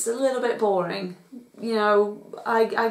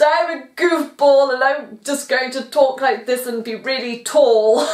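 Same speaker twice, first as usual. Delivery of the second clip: louder, higher silly comedy voice; Hugh Laurie goofball; 4 semitones higher, 6 dB louder than usual. Retold or imitated. Vlogger mimicking Hugh Laurie's old comedy persona, loud and goofy. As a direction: ham it up like a lanky British goofball. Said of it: "That's the kind of character he played".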